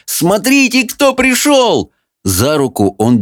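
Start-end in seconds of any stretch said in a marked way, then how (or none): 0.1-1.9 excited shout. Acted. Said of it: "с воплем".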